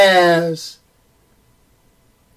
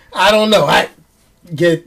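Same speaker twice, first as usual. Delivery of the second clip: normal talking voice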